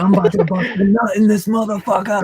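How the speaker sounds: high, fast, laughing mock elation; ecstatic. Retelling the women's triumph over him, ecstatic. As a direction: rush it out giddy, imitating someone overjoyed. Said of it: "They're like, fucking ecstatic".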